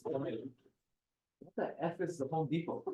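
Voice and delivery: bleeped expletive. Retold or imitated. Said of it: "I can't even say it 'cause it was an expletive".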